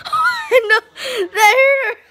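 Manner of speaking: very high, loud laugh-cry; real laughing cry. Real, not acted. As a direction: squeal in shock and laughter. Pet ate the bone.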